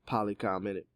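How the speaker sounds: usual speaking voice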